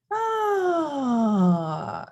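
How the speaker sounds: long high "ah" sliding steadily down; mock falling scream. Teacher imitating someone jumping off a cliff, mock scream. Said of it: "they're going to scream".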